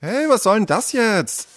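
high-pitched indignant protest; complaining. Acted. Little sister gets a blob of paint on her, complaining. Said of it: "beschwerte sich Jule".

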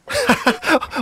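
high derisive giggle; mocking laugh. Sarah mocks Tom. (hohes, spöttisches Lachen)

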